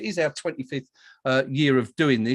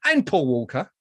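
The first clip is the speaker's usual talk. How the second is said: ordinary delivery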